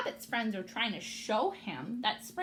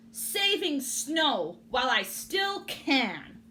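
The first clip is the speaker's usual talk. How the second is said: slow, louder grumble; grumpy rabbit, slow and louder; grumbling; 5 semitones higher, 7 dB louder, slower than usual. A narrator's reading. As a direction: grumble it slowly as a sulky bunny. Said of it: "Rabbit grumbled".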